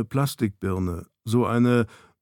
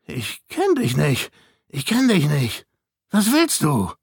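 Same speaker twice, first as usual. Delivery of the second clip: croaked out; 4 semitones higher than usual. A narrator's reading. Frightened man to the intruder in the cabin, croaking. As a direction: croak it out as if struck. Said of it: "krächzte hervor".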